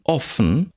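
"offen" with a short open o; short lax vowel. Shown as a demonstration. Lecturer reads minimal pairs, short lax vowel. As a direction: keep the o in "offen" brief.

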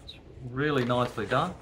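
ordinary talk, nothing marked

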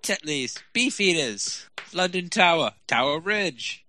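slow, high put-on English accent. Friend plugging his adventures in a mock English accent. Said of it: "I was doing an English accent".

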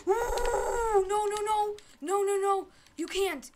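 very high, loud desperate wail; panicking gamer. Real, not acted. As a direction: scream in despair. Being eliminated.

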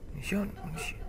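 very soft whisper. Real, not acted. Player looking for ammunition, whispering. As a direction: whisper it to yourself, barely voiced. Hushed repeat while searching.

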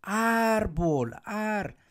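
high "árbol" with a long-held first syllable; calling out a word. Shown as a demonstration. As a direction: hail someone with árbol, stressing ar. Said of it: "voy a gritar la palabra".

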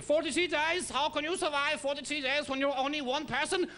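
high, loud mock German accent. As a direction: bark it in a hammed-up German accent. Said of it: "Germans probably saying".

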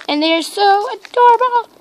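high, loud burst of adoration; gush, leaping up in pitch; squealed delight at something cute; excited gushing. Real, not acted. Gushing over her new toys.

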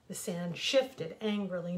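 plain reading voice

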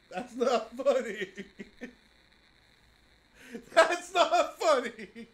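slow, laughing through the words; can't stop laughing while protesting. Real, not acted. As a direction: giggly protest. Laughing after a scare.

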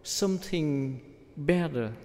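normal talking voice

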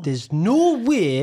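very slow, broad Geordie; Geordie Shore accent. Shown as a demonstration. Quoting a reality show to coach, drawn-out Geordie accent. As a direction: stretch "no way" out in Geordie. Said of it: "Should watch some Geordie Shore".

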